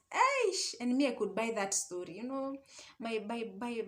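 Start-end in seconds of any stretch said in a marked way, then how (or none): 0.1-0.7 high, sharp Eish of disbelief; exclamation. Real, not acted. Telling her friends about her shock, a high Eish. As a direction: cry out in disbelief.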